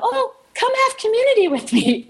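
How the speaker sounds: high-pitched, hammed-up beckoning; imitated sweet invitation. Imitating the woman waving her into the pew, high inviting voice.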